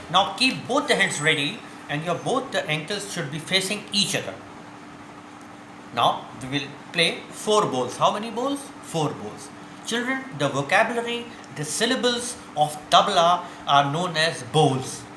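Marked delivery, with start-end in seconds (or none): none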